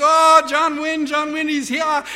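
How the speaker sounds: high, loud villagers' exclamation; hammed-up high cry of welcome; cheering villagers. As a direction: imitate an overjoyed welcoming crowd. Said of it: "they're going on and on".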